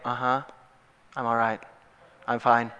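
low, absent-minded mumbled reassurance; lightly acted "I'm fine" denial; distracted brush-off. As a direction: answer as if staring off into the distance. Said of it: "just staring off in the distance".

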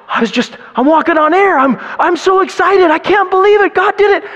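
high and loud giddy joy; thrilled imitation. Preacher voicing young Greg Laurie, giddy after a convert. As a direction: bubble over with disbelief. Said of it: "and he said".

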